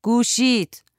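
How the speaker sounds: a contained shout to someone in another room; calling out. A narrator's reading. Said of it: "زن داد زد". (صدا زدن، با صدای کمی بلند و زیرتر)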